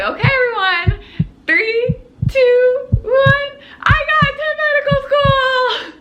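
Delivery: high, loud countdown into a triumphant shout; building countdown, ending in a high cheer; excited shouted announcement. Retold or imitated. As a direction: yell the big news to the whole room. Retold big announcement to family.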